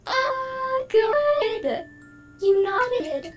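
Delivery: light up with joy; delighted. Acted. AI delighted player nodded.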